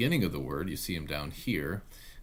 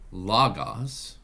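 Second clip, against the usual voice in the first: Logos as LOG-os, first syllable louder; stress on first syllable; 2 semitones higher, 6 dB louder than usual. Shown as a demonstration. Teacher reading a written Greek word, Logos stressed on LO. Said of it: "just to emphasize that syllable".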